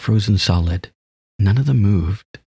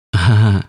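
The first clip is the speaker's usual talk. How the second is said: loud laugh; 2 semitones higher, 5 dB louder than usual. Real, not acted. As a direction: burst of laughter. Laughing at the name.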